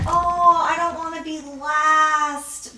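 high, loud, slow mock complaint; mock whiny voice. Host imitating a worried slime maker, mock whiny. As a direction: whine it like a nervous contestant. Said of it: "normally people would be like".

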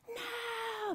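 put-on high-pitched, eager no from a child; children answering their Sunday school teacher; eager kids' no. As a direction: play the eager little kids answering. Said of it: "very enthusiastically".

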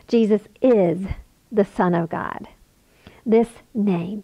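ordinary delivery

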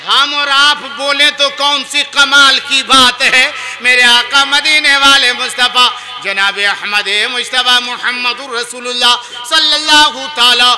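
loud, high declamation building to a shout; full-force preaching. Real, not acted. Preacher telling the crowd the Prophet's saying on greeting graves, impassioned.

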